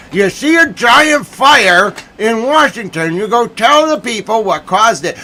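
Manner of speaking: high, outraged rant; outraged scolding. Real, not acted. Journalist scolding the press about reporting a giant fire, outraged. Lecturing absent press on their job.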